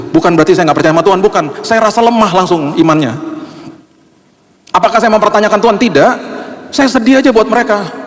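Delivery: high, intense preaching voice; impassioned preaching. Acted. Pastor urging mourners' comforters not to talk, impassioned preaching. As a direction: plead with the church.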